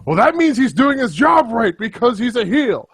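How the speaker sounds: high, far-off sneering fan voice; mock the fans in a silly high voice. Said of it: "has started in with".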